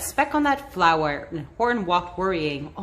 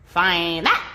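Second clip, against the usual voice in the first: sneer; 4 semitones lower than usual. A narrator's reading. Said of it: "sneered the bird".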